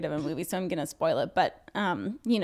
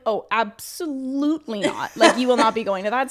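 firm parental refusal; 2 semitones higher, 6 dB louder than usual. Retold or imitated. Parents banning a child from American Pie, emphatic mock sternness. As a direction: put your foot down as a strict parent, lightly hammed-up.

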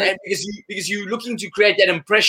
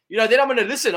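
ordinary talk, nothing marked

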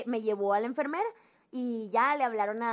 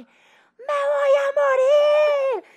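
higher, much louder, very slow sobbing wail; dramatic wailing cry; 13 dB louder, much slower than usual. Retold or imitated. Girl to her friend in the bathroom, hammed-up sobbing wail. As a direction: howl it out like a kid sure she is dying. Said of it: "Cassandra en dramas".

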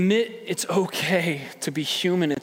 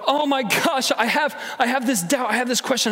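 mock panic; 6 semitones higher than usual. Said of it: "I need to hide it".

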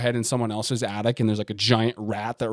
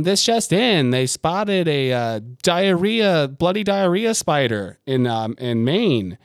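announcer voice, higher and louder, slowing down; mock news anchor; 5 semitones higher, 3 dB louder, slower than usual. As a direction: read it like breaking news on TV. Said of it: "The news will be like".

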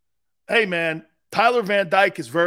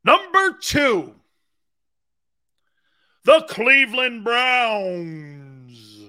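hype announcer; 3 semitones higher, 6 dB louder, much slower than usual. Acted. Mock countdown announcer, ranking O-lines.

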